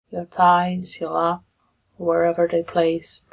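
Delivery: soft meditation guidance with long pauses; guided meditation. Real, not acted. Meditation leader guiding listeners into breath and body, softly and unhurried. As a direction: ease them gently through it, leaving long silences.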